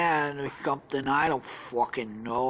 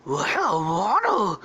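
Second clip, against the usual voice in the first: Scooby; 4 semitones higher, 4 dB louder, much slower than usual. Acted. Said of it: "Scooby and Shaggy see it".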